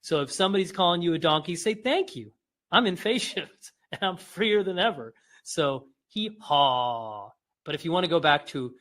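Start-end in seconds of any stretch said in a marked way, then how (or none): 1.8-5.1 high, playful, chuckling reply; light, amused comeback; mock cheerful retort. Acted cheerful reply to being teased. 6.1-7.3 short HEE and very drawn-out HAW; slow demonstrated donkey call; donkey bray. Shown as a demonstration. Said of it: "what a donkey sounds like".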